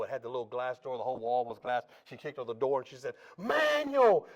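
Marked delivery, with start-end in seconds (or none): 3.4-4.3 high, loud frantic yell; screaming a name. Retold or imitated. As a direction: yell his name in panic. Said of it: "She starts screaming".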